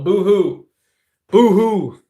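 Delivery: mocking fake crying sound; mock boo-hoo. Streamer mocks complainers with a sarcastic boo-hoo.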